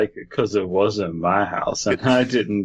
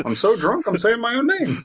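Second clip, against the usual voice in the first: high slurred cartoon dog; drunk Goofy voice; 13 semitones higher than usual.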